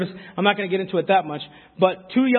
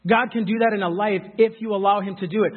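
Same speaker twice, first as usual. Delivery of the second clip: ordinary talk, nothing marked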